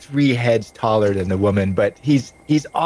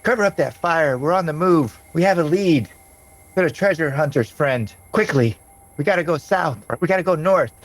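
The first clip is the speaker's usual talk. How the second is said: high-pitched commanding woman; woman giving orders; 7 semitones higher than usual. Acted. Woman ordering her camp to move out, high commanding voice. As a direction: pitch it up and issue brisk orders. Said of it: "you hear her give an order".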